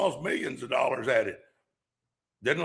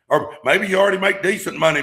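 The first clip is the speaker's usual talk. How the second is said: ordinary talk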